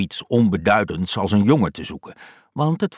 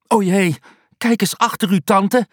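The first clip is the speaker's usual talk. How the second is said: high, loud mock alarm; faked alarm; 10 semitones higher, 4 dB louder than usual. Tom tricking his aunt, high faked alarm. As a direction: trick her into turning round.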